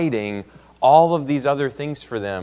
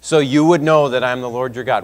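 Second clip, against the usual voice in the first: loud, higher, driving refrain; forceful preaching; 4 semitones higher, 9 dB louder than usual. Acted. Preacher voicing God to the Israelites, loud and forceful. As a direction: hammer the refrain home.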